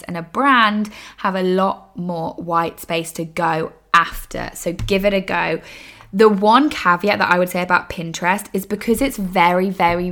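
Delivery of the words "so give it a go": normal talking voice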